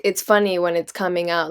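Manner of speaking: ordinary talk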